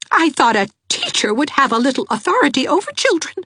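high, fast, bitter mother; a stung mother sniping at the teacher; quick, high, bitter jab; bitterly. A narrator's reading. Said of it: "said Missus Raymond bitterly".

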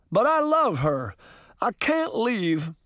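high, emotional declaration; heartfelt protest. Acted. Hamstead's emotional farewell to Granny.

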